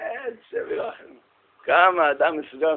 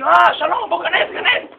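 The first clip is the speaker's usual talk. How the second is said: high, loud mock greeting; effusive welcome; 13 semitones higher, 9 dB louder, slower than usual. Synagogue warden beckoning a newcomer inside, effusively and loudly. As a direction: imitate an eager host calling a guest in.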